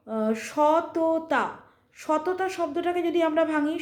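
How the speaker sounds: ordinary talk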